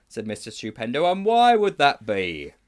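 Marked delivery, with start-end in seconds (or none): 1.1-2.5 slow, loud, high-arching question; booming sceptical interrogation; suspicious teacher. Acted. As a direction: draw out the suspicion loudly.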